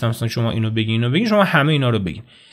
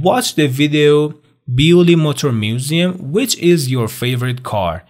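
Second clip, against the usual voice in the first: slow task reading; much slower than usual. Shown as a demonstration. Teacher reading the video task aloud to students. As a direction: read the instruction out for learners. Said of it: "کاری که شما باید انجام بدین".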